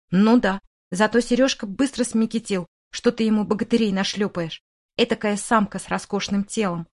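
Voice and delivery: ordinary delivery, nothing marked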